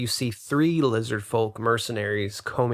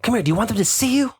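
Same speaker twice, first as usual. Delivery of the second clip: hidden elf hissing them over; 11 semitones higher, 5 dB louder, faster than usual. A narrator's reading.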